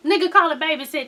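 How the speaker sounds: ordinary talk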